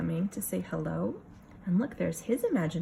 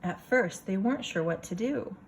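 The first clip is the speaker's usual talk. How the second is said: lively picture-book reading voice; story narration; 4 semitones higher than usual. A narrator's reading. Teacher reading a picture book to her class, storytelling voice. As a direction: read it for a room of kids.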